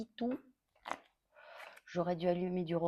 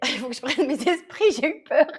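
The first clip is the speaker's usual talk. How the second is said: nervous laugh; 7 semitones higher, 7 dB louder than usual. Real, not acted. Laughing off the choking scare.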